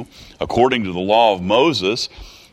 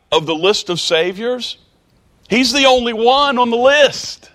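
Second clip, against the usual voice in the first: full-force preaching; 9 semitones higher than usual. Acted. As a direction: drive the point home from the pulpit.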